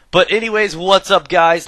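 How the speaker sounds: high, loud cheerful hello; loud greeting. Real, not acted. Streamer greeting viewers again, loud and high. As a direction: shout out to the chat.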